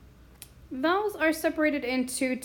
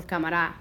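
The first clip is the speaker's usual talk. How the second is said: "cámara" as ca-ma-RA, pitched lower; stress on last syllable; 6 semitones lower, faster than usual. Shown as a demonstration. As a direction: land hard on final RA in "cámara". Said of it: "listen to where we hear the stress".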